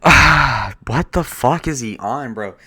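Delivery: loud, drawn-out disbelieving groan; exasperated outburst. Real, not acted. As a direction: sigh then blurt in disbelief.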